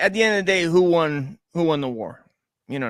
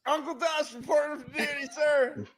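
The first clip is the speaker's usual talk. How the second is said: soldier reporting; 7 semitones higher, 9 dB quieter than usual. Pretend. Mock soldier reports for duty.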